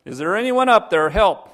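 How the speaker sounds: high, full shout for rescue; loud, high-pitched call for help. Acted. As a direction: yell up the cliff for anyone. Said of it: "Getting louder".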